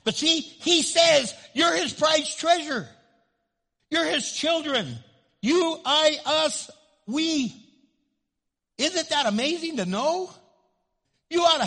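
loud, high, ringing sermon delivery; full-force preaching. Acted. Pastor thundering to his congregation, loud preaching. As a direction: preach it out big to the whole room.

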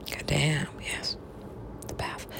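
soft mysterious whisper of "The path". Acted. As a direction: breathe "The path" like a secret. Whispers then shouts same phrase.

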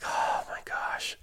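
slow, whispered sigh of disbelief; retold letdown, breathed out slowly; whispered exasperation. Employee fuming after the boss said no, whispered exasperation. Retold crushed reaction to refusal.

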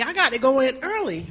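normal talking voice

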